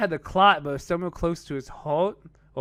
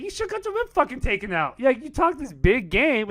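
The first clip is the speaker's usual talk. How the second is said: mock scolding; 9 semitones higher than usual. Mock scolding friend for backing out.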